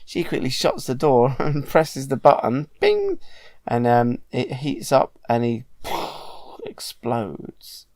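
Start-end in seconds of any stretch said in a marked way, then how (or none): 2.8-3.2 microwave bing. Retold or imitated. Microwave sound in retold film.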